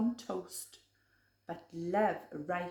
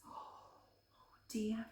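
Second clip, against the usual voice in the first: unvoiced hushed mock fright; gasp and whisper; 13 dB quieter than usual. Reader to child after claw marks, gasp and whisper. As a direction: gasp then breathe it. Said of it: "reader gasps and whispers".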